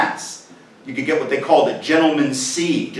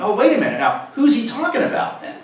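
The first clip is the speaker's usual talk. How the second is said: sudden realization; 7 semitones higher than usual. Retold or imitated. To the audience, mock sudden realization, rising high. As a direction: pitch jumping high on who's. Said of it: "that's when I realized something".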